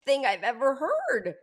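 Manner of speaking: helpless real amusement breaking into the words; laughing in disbelief. Real, not acted. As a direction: talk through your laughter. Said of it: "that I'm laughing".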